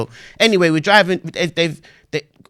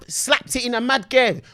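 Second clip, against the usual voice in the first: ordinary talk, nothing marked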